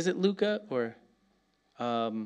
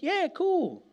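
casual shrug; 12 semitones higher than usual. Pretend. Acting casual about shining.

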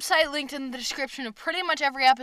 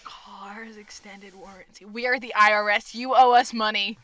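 louder, stern scam-caller bluster; mock IRS caller; 8 dB louder than usual. Playing a fake IRS caller demanding money, louder. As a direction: bark the demand in fake authority.

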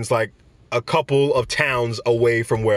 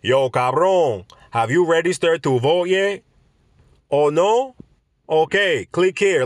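mock campaign text; 3 semitones higher, slower than usual. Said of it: "That's like saying".

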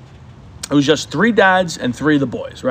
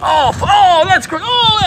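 kid reacting to stink; slower than usual. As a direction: mimic a kid gagging at the smell, high. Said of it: "one of the kids going like".

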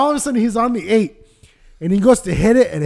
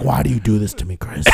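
lower, pleading groan of a beaten father; defeated dad; 9 semitones lower, faster than usual. Retold or imitated. Dad begging his son to stop mocking him, lower and defeated. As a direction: whine it low like a defeated man. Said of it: "He's defeated".

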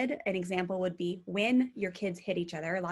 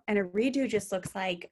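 ordinary talk

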